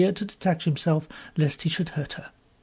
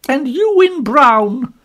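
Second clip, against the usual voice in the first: much higher, louder, slow rebuke; indignant; 9 semitones higher, 10 dB louder, much slower than usual. A narrator's reading. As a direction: turn on him with outrage. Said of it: "she said indignantly".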